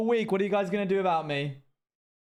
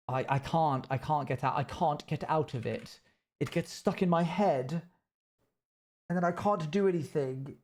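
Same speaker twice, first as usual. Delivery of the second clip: exaggerated British accent, Essex turning Chelsea; overly British accent. Streamer speaking completely differently to avoid slipping into cowboy, overly British accent. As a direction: put on an overdone Chelsea English accent. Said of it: "I have to speak completely differently".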